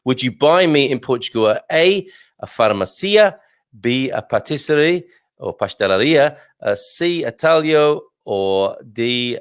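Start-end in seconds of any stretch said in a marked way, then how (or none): none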